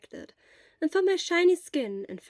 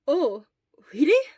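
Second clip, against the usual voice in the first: loud, higher surprised question; 4 semitones higher, 9 dB louder than usual. Acted. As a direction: sound taken aback. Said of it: "sounded more surprised".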